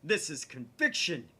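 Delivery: loud, slow declaration; grand conviction. Acted. Okabe declares his conviction to Kurisu, loudly. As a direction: land it with total certainty.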